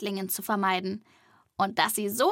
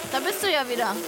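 delighted greeting; 3 semitones higher, faster than usual. Real, not acted. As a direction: greet a returning friend gleefully. Freudige Begrüßung der Figur. (freudig lachend begrüßt)

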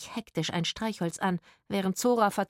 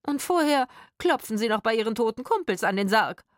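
loud, higher sarcasm; contemptuous mockery; 4 semitones higher, 5 dB louder than usual. A narrator's reading. Woman ridiculing partner's superstition, scornful. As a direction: jeer at his silly idea. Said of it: "sah den Mann verächtlich an".

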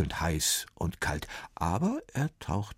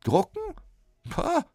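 very high, loud scornful scoff; 4 dB louder than usual. A narrator's reading. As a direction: scoff at the silly idea. Copyist scoffs at printing.

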